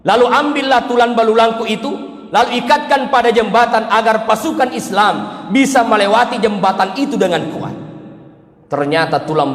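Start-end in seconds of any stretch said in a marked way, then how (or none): 0.0-7.9 high, raised earnest plea; Khadijah pleading. Acted. Devoted wife pleading to the Prophet, high and raised.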